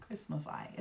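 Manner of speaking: Christmafy very soft, falling off. Shown as a demonstration. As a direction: let Christmafy fade away quietly. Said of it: "Is that a word?".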